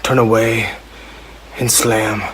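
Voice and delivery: reading pop lyrics as a grim northern hero, understated. Acted. Podcast host reads Let It Go lyrics as Jon Snow, lightly voiced. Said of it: "reading lyrics from Let It Go as Jon Snow".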